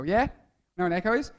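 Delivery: ordinary talk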